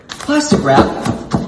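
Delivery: slow whisper. Real, not acted. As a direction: breathe out Plastic wrap slowly. Whispered recipe step.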